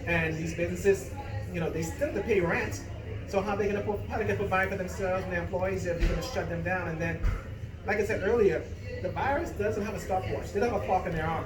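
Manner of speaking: ordinary talk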